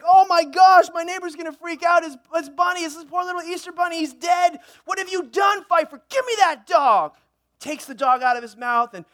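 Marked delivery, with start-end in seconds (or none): 0.0-7.1 higher, frantic acted freak-out; panicked master. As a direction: fret wildly, then scold the dog. Dog's master panics over dead bunny.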